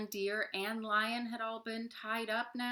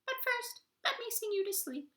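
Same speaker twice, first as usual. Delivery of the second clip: very high squeaky polite voice; tiny toad voice. Acted. Tree Toad interrupting the animals, very high squeaky voice.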